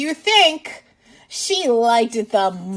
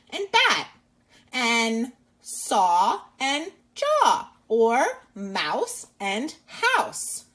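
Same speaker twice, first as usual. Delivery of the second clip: slow rhyme demo; much slower than usual. Shown as a demonstration. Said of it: "rhyming words are words that have the same sound".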